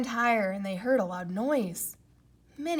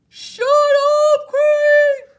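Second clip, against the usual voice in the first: very high, loud furious scream; angry scream; 18 dB louder, much slower than usual. Acted. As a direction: scream him silent. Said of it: "screamed Toad".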